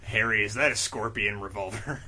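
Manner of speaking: low, soft gravel of a tough hero; low, quiet tough-guy voice; gruff hero voice. Acted. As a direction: read it like a brooding action hero. Low character lines.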